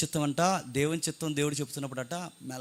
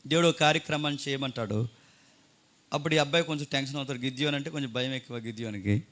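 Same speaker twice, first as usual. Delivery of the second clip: normal talking voice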